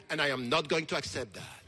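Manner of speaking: normal talking voice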